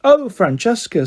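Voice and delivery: high, drawn-out simper; gushy, silly sweetness. A narrator's reading. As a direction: simper as if delighted by the caller. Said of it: "in a silly, simpery out sort of way".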